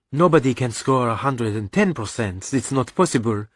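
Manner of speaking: reasoning mother. Acted. Mother answering her son's exaggeration, reasoning. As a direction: counter calmly as the mother.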